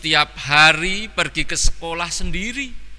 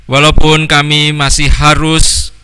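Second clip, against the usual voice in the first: louder, earnest prayer voice; Christmas prayer; 7 dB louder than usual. Acted. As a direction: pray fervently for the congregation, full voice. Leads congregation in prayer. (doa yang khusyuk dan lantang)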